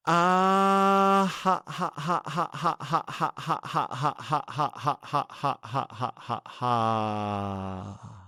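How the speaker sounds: high held "ha", long staccato run sinking to normal pitch; laughter breathing pattern. Shown as a demonstration. Teacher demonstrates three-stage out-breath, staccato laughter. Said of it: "That's the pattern for breathing".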